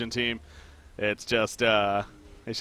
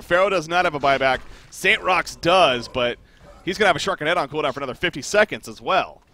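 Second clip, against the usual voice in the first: usual speaking voice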